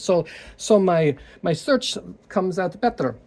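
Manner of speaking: put-on a quick stab at an Indian accent that doesn't land; failed Indian accent. Mimicking an Indian scammer to his viewers in a weak Indian accent. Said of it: "sorry, I can't do an Indian accent".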